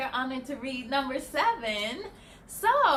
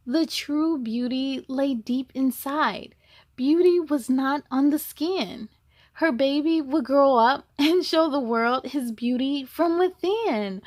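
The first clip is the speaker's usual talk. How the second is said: louder, lively storybook narration; reading to children; 5 dB louder than usual. A narrator's reading. Reading the zebra story to kids, lively and louder. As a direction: tell it brightly to the little ones. Reads the picture book aloud.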